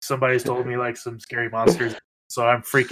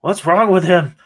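put-on alarmed child; 6 semitones higher, 8 dB louder than usual. Child scared by Proto.

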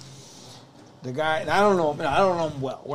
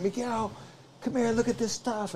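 higher-pitched imitation of an excited dad; excited calling; 6 semitones higher, 4 dB quieter than usual. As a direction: call your son over, thrilled. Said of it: "he was so excited".

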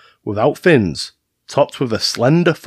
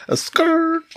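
high-pitched imitation of skidding tires; mock tire screech. Co-host mimics the friend's getaway car, playful high screech.

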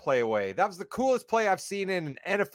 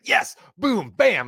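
punchy, louder imitation of eager critics; mock gleeful outburst; 5 dB louder, slower than usual. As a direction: hit each word like a gleeful attacker. Acts out gleeful pouncing critics.

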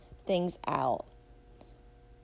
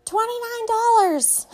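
much higher, loud squawking yell; mock shout; 13 semitones higher, 7 dB louder than usual. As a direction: belt it into the phone. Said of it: "shouts into the phone".

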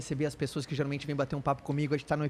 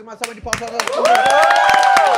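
very high, loud, slow cheer; big shout; 16 dB louder, slower than usual. Real, not acted. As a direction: call it out to the whole crowd. Host calls for applause.

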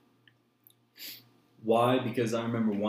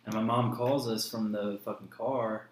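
ordinary talk, nothing marked

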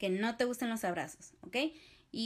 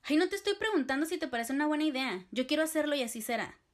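louder, higher, fast and curt; aggressive reply; 5 semitones higher, 4 dB louder, faster than usual. Shown as a demonstration. To a doubter of your business idea, fast angry retort. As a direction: cut them off, fast and sharp. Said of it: "Una respuesta agresiva sería como".

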